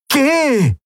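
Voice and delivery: very high voiced sharp order; commanding Aylan. A narrator's reading. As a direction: snap the command, pitched high. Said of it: "befahl die Shayang".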